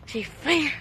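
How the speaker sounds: ordinary delivery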